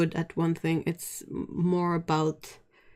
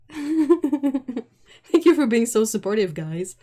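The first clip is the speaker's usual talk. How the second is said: slow, louder laughing thanks; laughing sarcasm; 5 semitones higher, 6 dB louder, slower than usual. Real, not acted. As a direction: laugh through a dry thank you.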